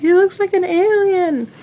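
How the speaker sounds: high mock horror at the baby; shocked. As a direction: react to the newborn in disbelief. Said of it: "and I was like".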